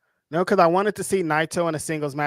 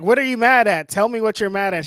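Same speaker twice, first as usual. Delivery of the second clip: louder, higher pestering question; mock exasperated demand; 5 semitones higher, 5 dB louder than usual. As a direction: imitate someone pressing for an answer. Acted quarrel, pressing his partner.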